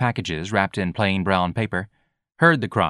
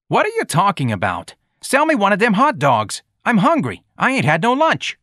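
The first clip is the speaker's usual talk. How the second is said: put-on high, loud demanding boy; complaining street kid; 11 semitones higher, 4 dB louder than usual. A narrator's reading. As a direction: pester him like a hungry young tough. Voicing George demanding food.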